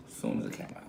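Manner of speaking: hushed whispered repeat; whispering. Real, not acted. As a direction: whisper it to yourself.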